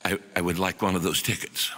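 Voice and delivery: humble Chinese professor repeating his request in broken English. Retold or imitated. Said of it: "again says".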